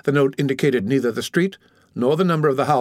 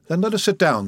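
higher-pitched, mildly impatient urging; understated impatience, pitched up; 6 semitones higher than usual. A narrator's reading. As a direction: urge them on with a touch of impatience. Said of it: "said Villefort impatiently".

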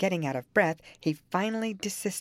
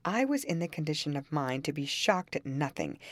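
plain reading voice

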